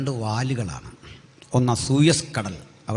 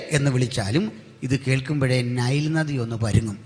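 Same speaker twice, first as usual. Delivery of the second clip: ordinary delivery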